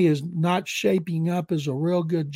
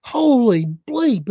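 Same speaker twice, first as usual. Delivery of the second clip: high, loud and drawn out slowly; stunned exclamation; 6 semitones higher, 7 dB louder, slower than usual. Retold or imitated. Actor realizing who's on the phone, in loud, slow amazement. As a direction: let it burst out in slow amazement.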